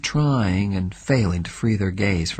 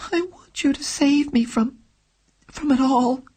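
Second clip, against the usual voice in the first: pleading, slightly higher and unsteady; a hint of a tremble, a touch higher; thin, tremulous. A narrator's reading. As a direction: let the voice thin out as she begs. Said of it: "she replied in a thin, tremulous voice".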